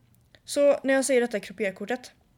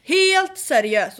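very slow, loud and high; drawn-out loud retold scolding; angry; 7 semitones higher, 10 dB louder, much slower than usual. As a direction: spell it out, furious.

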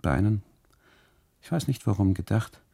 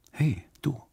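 higher, playful little call across the room; flirty call; 7 semitones higher than usual. Retold or imitated. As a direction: beckon him over with a teasing hey. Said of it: "sage ich mit dem Zeigefinger". (kokett lockender Zuruf, höher)

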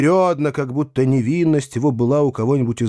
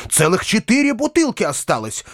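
higher-pitched, eager exclamation; eager interjection; 9 semitones higher than usual. A narrator's reading. Praskovya says there is plenty left, eagerly. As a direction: chime in proudly to reassure everyone. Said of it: "подхватила Прасковья Ильинична".

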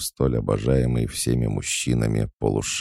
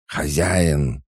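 higher-pitched, mildly raised cry; insistent call; 4 semitones higher than usual. A narrator's reading. Ignored she-wolf cries out to her master, insistently. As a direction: cut in, demanding attention. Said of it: "игнорируя крики волчицы".

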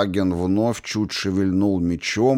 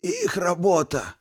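wheezing hiss; 11 semitones higher than usual. A narrator's reading. Archmage continues his wheeze.

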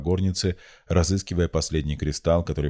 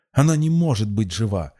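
higher, louder outraged exclamation; furious; 6 semitones higher, 5 dB louder than usual. A narrator's reading. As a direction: cry it out in furious denial. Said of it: "яростно воскликнула".

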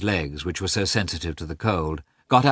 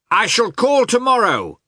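high, loud shout across the street; Oblonsky shouting to Karenin as he drives off; 13 semitones higher, 6 dB louder than usual. Acted. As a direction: shout it after the carriage. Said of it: "shouted Oblonsky to him".

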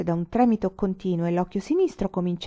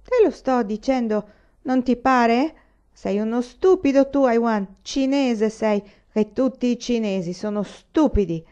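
put-on drunk lieutenant insulting; 5 semitones higher, 5 dB louder than usual.

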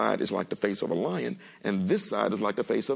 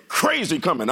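punched-up stress on "crazy"; emphatic inflection; 5 semitones higher, 4 dB louder than usual. Shown as a demonstration. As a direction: lean hard on "crazy", then finish "coming". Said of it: "I just happen to put the inflection on the word".

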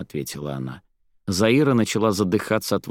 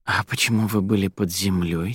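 ordinary delivery

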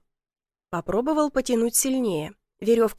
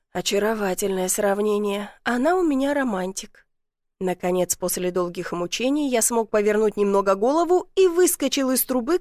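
ordinary delivery

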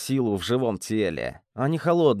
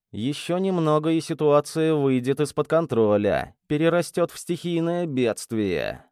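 ordinary delivery, nothing marked

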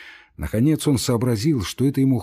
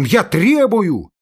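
desperate demand; 9 semitones higher, 6 dB louder, slower than usual. Acted. Andrey demands release.

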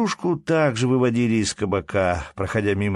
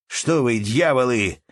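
raised, loud challenge; defensive shouting; 5 semitones higher, 5 dB louder, slower than usual. A narrator's reading. Cornered Ermolai faces the crowd, shouting defensively. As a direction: shout back at the attackers. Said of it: "кричал Ермолай".

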